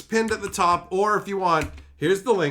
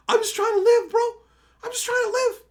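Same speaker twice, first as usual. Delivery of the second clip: mock whine; faster than usual. Self-pitying exaggeration.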